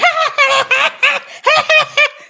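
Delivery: high, loud imitated cackle; mock cackle. Imitating the vice president's laugh at the button, high loud cackle. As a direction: cackle wildly like a cartoon villain. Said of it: "I can't even cackle like that".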